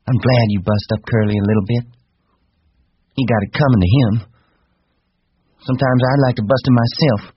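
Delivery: high-pitched woman's character voice; high, spiteful female character; spiteful wife. Acted.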